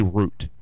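route said as "root"; "root" pronunciation. Shown as a demonstration. Radio host showing how some people say it, route as "root". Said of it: "some people say route".

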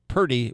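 "purty" with the r before the vowel; folksy mispronunciation. Shown as a demonstration. Host recalling announcer class, showing the wrong "purty". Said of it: "was not one of the words".

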